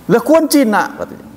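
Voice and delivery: lightly mocked Javanese jab; Javanese taunt. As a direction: imitate a villager teasing.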